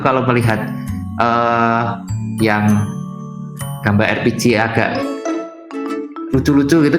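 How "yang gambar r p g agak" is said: ordinary delivery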